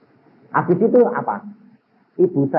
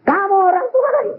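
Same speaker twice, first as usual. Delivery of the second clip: lightly voiced stern parent, pitched up; acting like a parent. Boy scolding his own parents, mock parental sternness. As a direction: scold them as if you were the parent. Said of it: "kayak orang tua sampai bilang".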